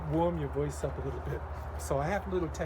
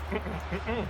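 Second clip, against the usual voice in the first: hummed warm-up; 6 semitones higher than usual. Shown as a demonstration. Singer demonstrating several hums. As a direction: hum in a short string. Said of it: "you say".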